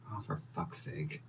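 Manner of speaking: whispered exasperated curse; muttered curse. Acted. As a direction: breathe the curse under your breath. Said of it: "Absco goes".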